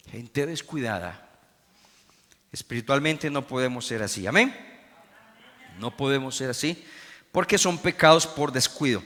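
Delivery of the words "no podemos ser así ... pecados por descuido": ordinary talk, nothing marked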